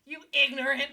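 loud, high, laughing mock insult; mock outrage. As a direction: hurl a joking insult through laughter. Mock insult over pronunciation, laughing.

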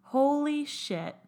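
slow, stunned exclamation; amazed. Real, not acted. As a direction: let it sink in, drawn out in awe. Moved by friend's thoughtful message.

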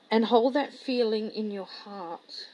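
very soft, unhurried visualisation voice; hushed meditation guide; guided meditation. Real, not acted. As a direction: guide them softly inward, with long pauses.

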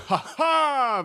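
high gloating ha ha; triumphant laugh. Acted. Host gloating over the guest, a high triumphant laugh. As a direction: crow over a won debate. Host declares himself the winner.

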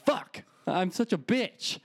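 slow, high mock self-reproach; self-disgust. He scolds himself in his head, high and slow. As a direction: groan it at yourself, high and slow. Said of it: "And I'm like".

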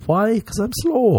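high silly question and answer; high mock self-answer. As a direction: squeak the answer.

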